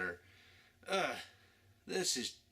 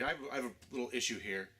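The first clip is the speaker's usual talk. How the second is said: usual speaking voice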